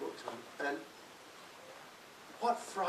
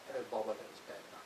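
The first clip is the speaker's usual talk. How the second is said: a hint of the quiet, calm English agent, softer and quick; 6 dB quieter, faster than usual. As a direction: lightly voice the gentle English agent. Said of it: "very quiet, very calm English girl".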